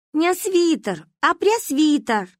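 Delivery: high, slow, stressed put-right; indignant correction. A narrator's reading. Girl crossly corrects the misheard word. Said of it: "рассердилась девочка".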